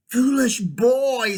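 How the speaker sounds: high, loud, drawn-out scorn; imperious witch. A narrator's reading. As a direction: scold like a haughty witch, slowly. Witch scolds the fleeing boy.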